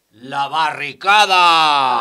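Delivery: hammed-up cry to the barricades; mock rallying cry. Mock cry to the barricades at a friend, loud and slow.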